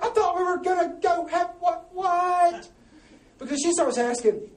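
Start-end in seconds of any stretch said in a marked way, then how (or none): 0.0-2.7 high, loud, drawn-out disbelief; hammed-up baffled husband, wailing at a high pitch; mock exasperation. Husband whose wife wants to talk, mock exasperated wail.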